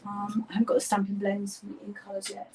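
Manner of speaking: soft whispered aside; half-whispered craft chat; whispering. Real, not acted.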